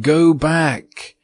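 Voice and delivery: small tapestry figures urging her back; little huntsmen warning Virginia to turn back; tiny huntsmen crying out. A narrator's reading. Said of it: "they cried".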